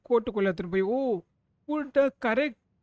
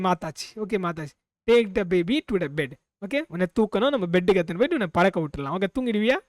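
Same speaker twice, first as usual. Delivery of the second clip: ordinary talk, nothing marked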